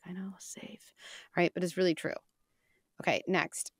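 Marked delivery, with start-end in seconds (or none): 0.0-0.9 breathy whispered answer, resigned; whispered kid reply. Retold or imitated. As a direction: whisper it like a kid who knows the drill.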